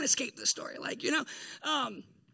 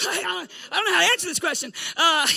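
flustered laugh; 4 semitones higher, 9 dB louder than usual. Real, not acted.

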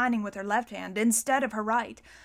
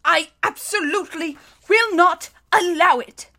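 high, loud, indignant refusal; emphatic refusal; 7 semitones higher, 8 dB louder than usual. A narrator's reading. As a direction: put your foot down with pompous force. Banker forcefully refuses after coughing.

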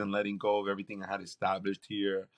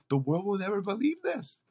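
higher, amazed aside; 10 semitones higher than usual. Retold or imitated. Son recording his mom, amazed. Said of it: "I'm like".

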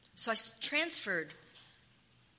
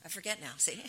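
ordinary talk, nothing marked

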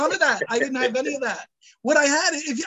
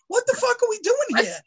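baffled outburst; 9 semitones higher than usual. Retold or imitated. Retelling panicked call with lab.

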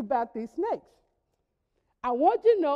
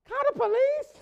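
much higher, disbelieving question; incredulous; 8 semitones higher than usual. Retold or imitated. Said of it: "I thought".

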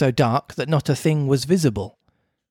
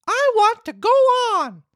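high insistent puppet voice; insist, high and stubborn; stubborn Pinocchio. Acted.

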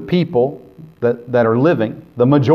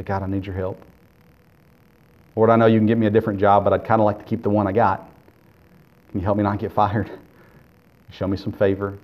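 hushed prayer; 3 semitones lower than usual. Acted. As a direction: murmur it to God as a private prayer. Voices a believer's prayer.

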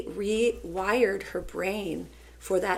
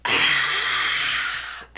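loud, long imitated exhale; big exhaled sigh; 5 semitones lower, 6 dB louder than usual. Teacher imitating student's deep breath, loud sigh. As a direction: let out a big, noisy breath. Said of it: "She took a nice big deep breath".

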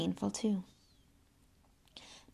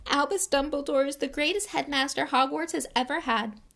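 high, urgent elf warning; high house-elf voice; 7 semitones higher, 4 dB louder than usual. A narrator's reading. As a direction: plead to save him.

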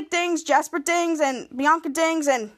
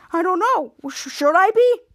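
high, pointed comeback, louder at end; acted snide retort; 5 semitones higher, 5 dB louder than usual. Henry answering whether he is mad, snide. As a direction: toss the question back pointedly.

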